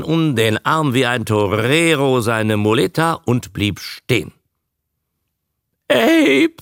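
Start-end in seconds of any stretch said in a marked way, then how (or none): 5.9-6.5 high, loud wail of despair; desperate wail. A narrator's reading. Frightened woman calling her companion from behind, desperate high wail. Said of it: "jammerte es hinter ihm verzweifelt".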